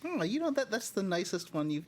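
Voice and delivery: ordinary talk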